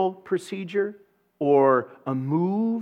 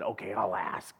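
giving in; 9 semitones lower, 10 dB quieter than usual. Retold or imitated. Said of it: "finally I broke down and said".

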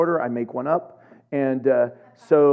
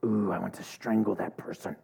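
much lower and softer, a muttered inner voice; mock menacing thought; 8 semitones lower, 8 dB quieter than usual. Voicing a passing angry thought to the congregation, a low muttered menace. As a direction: let the dark thought slip out under your breath.

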